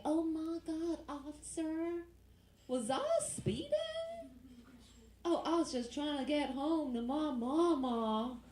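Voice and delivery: high, drawn-out Southern belle act; southern accent. Retold or imitated. Girlfriend talks her way out with a cop, high Southern accent. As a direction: plead innocence in a syrupy Southern accent. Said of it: "she uses a southern accent".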